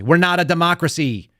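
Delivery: usual speaking voice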